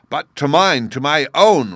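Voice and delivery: loud, high enraged roar; roaring king. A narrator's reading. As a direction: bellow it in a rage. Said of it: "roared the king".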